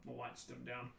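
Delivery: ordinary delivery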